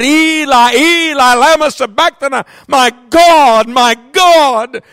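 anguished cry from the cross; Christ crying out. Acted. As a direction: cry to heaven in abandonment. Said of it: "Christ cried".

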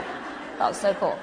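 whispered through a giggle; laughing whisper. Acted. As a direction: breathe it out with a laugh. Repeats line, second take whispered.

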